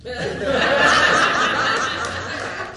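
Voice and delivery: unvoiced high giggle; high breathy laugh. Real, not acted. Speaker laughing at the client's slipper story, high giggle. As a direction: laugh at your own punchline.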